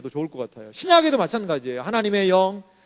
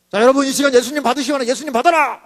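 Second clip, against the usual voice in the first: louder, higher, booming pulpit command; mock preacher command; 5 semitones higher, 5 dB louder than usual. Preacher booming at the congregation, mock command. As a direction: proclaim it like an odd altar call. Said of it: "이렇게 얘기하면 어때요?".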